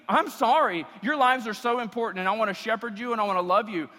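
ordinary talk

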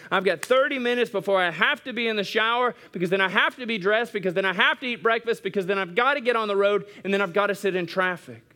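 quick, hurried rattling-off; hurried morning prayer, rattled off; rushed morning list. Retold or imitated. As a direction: race through the to-do list. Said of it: "we say".

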